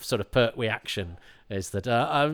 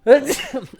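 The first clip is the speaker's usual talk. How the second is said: sudden loud, high sneeze; real sneeze bursting out; 13 semitones higher, 12 dB louder than usual. Real, not acted. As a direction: let out an explosive sneeze. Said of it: "I'm gonna sneeze".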